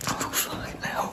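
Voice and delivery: breathless whispered curse; shocked whisper. Real, not acted. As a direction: whisper it in fright. Hears a child's voice.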